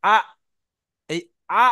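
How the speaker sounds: আ cut off with a glottal catch at the throat's start; hamza articulation demo. Shown as a demonstration. Teacher shows students where hamza is articulated, demonstrating. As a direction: stop আ sharply on the hamza. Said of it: "হামজাটা গিয়ে যেখানে উচ্চারণ হচ্ছে".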